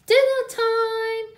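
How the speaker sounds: very loud, high, slow triumphant shout; spring the trap with a big yell. A narrator's reading. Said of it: "shouted Little Wolf and Smells together".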